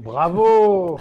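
loud, high bravo with laugh; shouting bravo at the impression; delighted cheer. Real, not acted.

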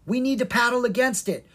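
high-pitched urgent call; urgent cry. A narrator's reading. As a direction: cry out to rally the rowers. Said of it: "Kara cried".

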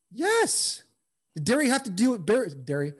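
ordinary talk

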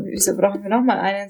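ordinary delivery

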